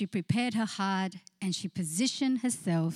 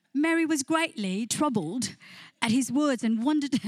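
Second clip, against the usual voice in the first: mock British accent, higher and louder; 4 semitones higher, 3 dB louder than usual. Pastor trying her husband's British reading on the church, in a mock British accent. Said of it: "I tried to sound all British".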